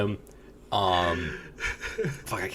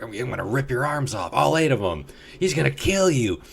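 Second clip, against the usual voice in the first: high mocking trash talk; taunting ring girl; 7 semitones higher than usual. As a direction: taunt him from the ramp. Ring girls taunting Spider-Man.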